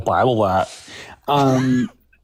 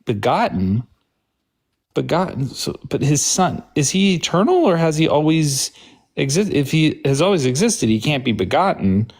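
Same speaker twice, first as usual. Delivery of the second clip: puzzled questioning; 6 semitones higher than usual. Shown as a demonstration. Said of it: "then I'd go like this".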